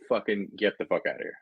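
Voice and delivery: ordinary talk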